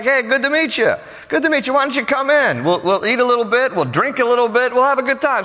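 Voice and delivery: high-pitched, hearty mock welcome; welcoming father-in-law. Father-in-law greets his son-in-law, eagerly welcoming. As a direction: play an eager host ushering in a guest.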